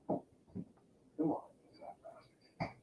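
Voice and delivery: soft unvoiced coaxing; coaxing the cat. Real, not acted. Coaxing his fat cat to come closer, soft. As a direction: coax the cat over, breathless and soft.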